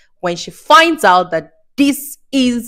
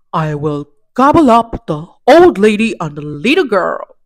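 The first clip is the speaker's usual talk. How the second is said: menacing wolf plotting, a touch louder; 3 dB louder than usual. Acted. Narrator voicing the wolf plotting to eat grandma, greedy menace.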